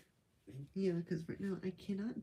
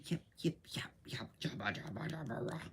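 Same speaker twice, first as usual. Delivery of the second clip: silly gibberish; 2 semitones lower than usual. Pretend. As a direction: babble like a goofy cartoon creature. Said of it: "I do craziness".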